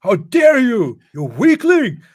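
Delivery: high, loud mocking yell; mock angry shout. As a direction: bark it like an outraged preacher. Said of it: "that's your response to people doubting".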